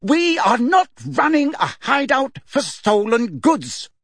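mother yelling through the house; shouting. A narrator's reading. Mrs Weasley shouting at the thieves downstairs. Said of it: "shouting at the top of her voice".

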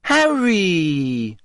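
beaming. A narrator's reading. Journalist greeting Harry across the pub, beaming. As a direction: gush his name with a big fake smile.

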